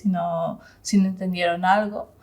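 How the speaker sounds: ordinary delivery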